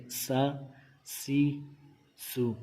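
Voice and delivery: سا، سی، سو with a thin, sharp s; softly whistle the s in سا، سی، سو; whistling sin. Shown as a demonstration. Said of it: "یہاں پہ سیٹی کی آواز نکالیں گے".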